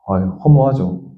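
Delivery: ordinary talk, nothing marked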